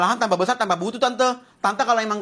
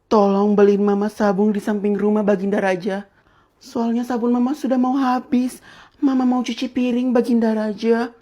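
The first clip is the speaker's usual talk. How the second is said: mock courtly plea; 4 dB louder than usual. Mock-formal pleading to king.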